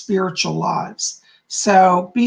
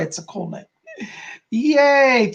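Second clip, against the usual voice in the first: normal talking voice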